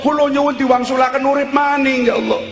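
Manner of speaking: high, wailing cry to God; acted wail of the damned; crying plea. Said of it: "Kowe wong pada nangis".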